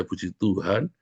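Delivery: usual speaking voice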